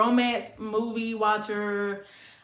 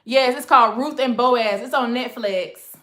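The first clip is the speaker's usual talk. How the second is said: normal talking voice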